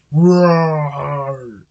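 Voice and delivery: loud drawn-out roar; bear roar. A narrator's reading. Bear appears in story.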